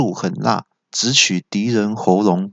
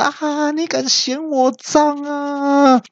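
very high, slow, stretched battle cry; insulted villain shrieking his attack; furious yell; slower than usual. A narrator's reading. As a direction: howl the move name like an offended brute. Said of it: "三怪听到就大叫说".

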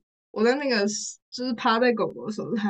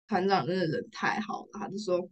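ordinary talk

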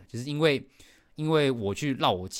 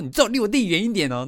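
higher stern warning, stressed 最; protective warning; 11 semitones higher, 7 dB louder than usual. Retold or imitated. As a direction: warn her off firmly. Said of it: "反正就是说". (姐姐护弟弟，严厉警告对方)

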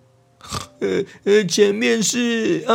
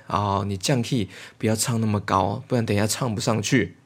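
deep murmured aside; a low voice giving advice; low soft side voice; 12 semitones lower, 7 dB quieter than usual. Acted. Plays another character whispering advice.

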